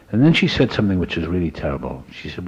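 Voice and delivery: ordinary talk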